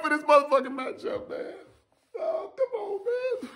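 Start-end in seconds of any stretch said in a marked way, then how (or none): 2.2-3.5 very high, soft, laughing whine; laugh-cry whine. Real, not acted. Vlogger overwhelmed by the matchup, high laughing whine. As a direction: squeal like it hurts so good.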